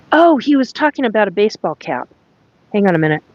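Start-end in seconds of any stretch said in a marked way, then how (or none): 0.1-2.1 it suddenly clicks; real startled gasp of understanding; sudden realisation. Real, not acted. Sudden realisation about baseball cap.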